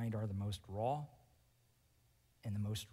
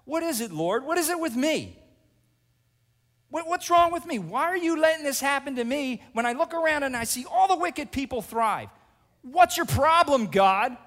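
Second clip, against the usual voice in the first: much higher, loud, exasperated yell; brazen outburst at God; 12 semitones higher, 11 dB louder than usual. Acted. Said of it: "how you want to talk to God".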